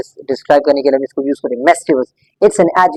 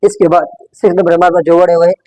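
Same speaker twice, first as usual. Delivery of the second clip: ordinary delivery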